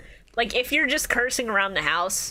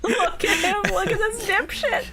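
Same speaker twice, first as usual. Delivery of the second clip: higher, laughing through the words; laughing hard; 10 semitones higher than usual. Real, not acted. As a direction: cackle while mocking him. Laughing at friend.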